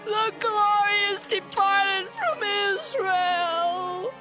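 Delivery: high, slow tearful wail; dying mother lamenting. Acted. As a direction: cry out in grief, very high and drawn out. Dying mother names her child.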